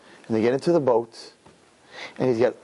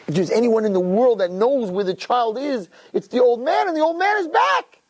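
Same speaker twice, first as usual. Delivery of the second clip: impassioned storytelling sermon; 9 semitones higher, 3 dB louder than usual. A narrator's reading. Storyteller announces the old man's return, rising impassioned cry. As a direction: pitch climbing to a high cry.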